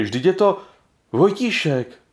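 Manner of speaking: high, startled recognition; surprised. A narrator's reading. Jiřík recognising the sick Vojtíšek, high and startled. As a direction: gasp it out as you recognise him.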